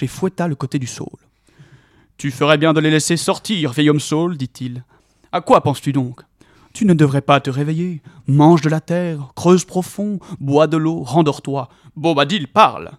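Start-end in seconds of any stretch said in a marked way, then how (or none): 2.2-4.4 loud stern command; commanding scolding. A narrator's reading. Tom commanding the willow. 5.3-12.9 stern command. A narrator's reading. Tom commanding the willow.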